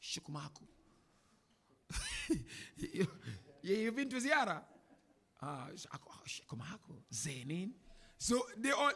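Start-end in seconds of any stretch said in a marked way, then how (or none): 0.0-0.6 quick-fire snippets of Iraqi Arabic, said softly; imitated Iraqi accent. Teacher showing how Arabs differ by country, light Iraqi accent. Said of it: "You go Iraq". 5.4-7.7 soft, light take on Iraqi Arabic; imitated Iraqi accent. Teacher joking with someone who has been to Ziyara, soft Iraqi accent. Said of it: "You go Iraq".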